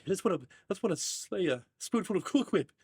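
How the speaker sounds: high voice, "Whip" said with a breathed H first, hwip; over-emphasized H in Whip. Acted. Quoting a spoonful of Cool Whip, "Whip" with overdone H. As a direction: puff an exaggerated H into "Whip". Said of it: "putting so much emphasis on the H".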